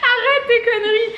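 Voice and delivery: high, breathless laugh. Real, not acted. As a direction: laugh while scolding. Laughing to tears.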